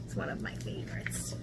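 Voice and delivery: soft whispered aside. Real, not acted. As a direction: breathe it like a secret.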